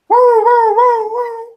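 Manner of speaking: high, loud wailing siren noise; siren imitation. Game master voicing sirens heard off in the distance, high wail. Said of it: "off in the distance, you hear".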